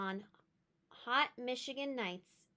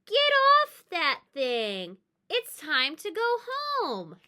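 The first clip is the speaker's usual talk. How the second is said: loud, slow, higher call to come down; stern call; 7 semitones higher, 9 dB louder, slower than usual. Acted. Grandmother calls the child off the merry-go-round, loud and slow. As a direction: call her off the ride firmly.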